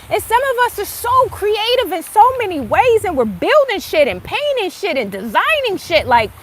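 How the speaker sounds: ordinary talk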